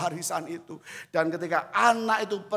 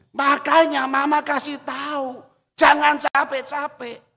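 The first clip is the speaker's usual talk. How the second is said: louder, higher scolding mother; mother scolds her pastor son, louder; 9 semitones higher, 7 dB louder than usual. Retold or imitated. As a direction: nag him sharply like a mother.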